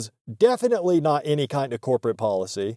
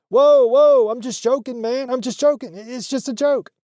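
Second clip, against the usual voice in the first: high-pitched panicky imitation, loud at first; stoner guy backing off; 10 semitones higher, 5 dB louder than usual. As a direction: back off fast in a high stoner voice. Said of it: "the stoner guy was like".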